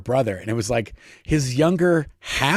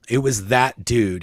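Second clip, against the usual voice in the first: normal talking voice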